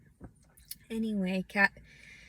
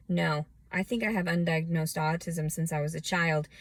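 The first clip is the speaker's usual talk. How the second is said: ordinary talk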